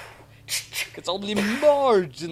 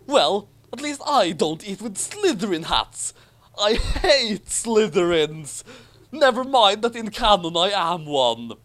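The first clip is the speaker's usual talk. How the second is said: higher, louder, petulant schoolboy Draco; blustering Draco voice; 7 semitones higher, 5 dB louder than usual. A narrator's reading. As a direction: spit it out like a sulky bully.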